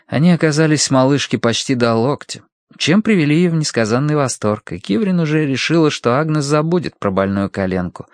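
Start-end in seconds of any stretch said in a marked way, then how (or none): none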